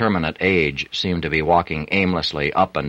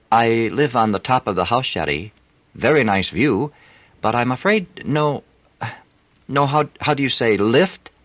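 put-on higher voice with a French accent; a flirty French streetwalker; 7 semitones higher than usual. A narrator's reading. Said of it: "unmistakable French accent".

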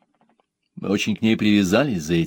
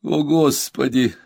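moan; 6 semitones higher, 3 dB louder, slower than usual. Acted. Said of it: "простонала Наташа".

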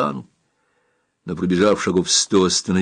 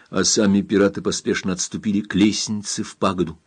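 ordinary delivery, nothing marked

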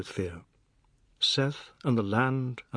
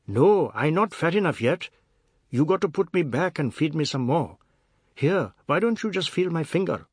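higher, louder mocking husband; sarcastic retort; 5 semitones higher, 4 dB louder than usual. A narrator's reading. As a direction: jeer at her, sarcastic and loud.

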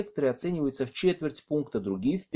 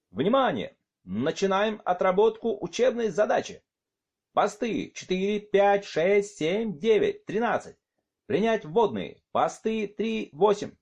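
loudspeaker voice; 8 semitones higher than usual. Acted. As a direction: bark orders through a PA system. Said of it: "из громкоговорителей под большими мониторами послышались его команды". (команды через громкоговоритель)

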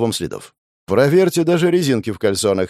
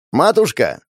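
shouting; 5 semitones higher, 4 dB louder than usual. Acted. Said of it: "снова прокричал Костенко".

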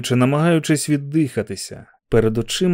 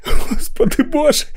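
laughing cry; 13 semitones higher, 5 dB louder than usual. Acted. Repeated exclamation.

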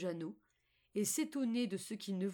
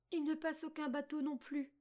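put-on little girl Margot; 7 semitones higher than usual. A narrator's reading. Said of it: "renchérit Margot".

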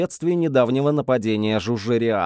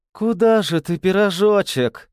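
higher, teasing purr; 7 semitones higher, 3 dB louder than usual. A narrator's reading. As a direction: purr it like a cat toying with prey. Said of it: "промурлыкала она".

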